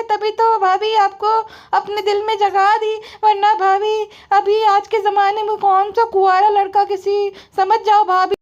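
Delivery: high sarcastic taunt; sarcastic brother-in-law. Retold or imitated. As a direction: needle her in a high squeaky tone.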